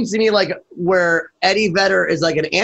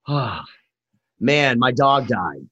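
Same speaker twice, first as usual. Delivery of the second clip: mock gloom; 6 semitones lower, much slower than usual. Interviewer imitating the gloomy singer after big sales, mock dejected. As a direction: sigh it out, imitating a downcast star.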